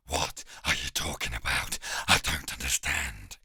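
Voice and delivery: deep frightened whimper; whining hound. Acted. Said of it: "The hound whines".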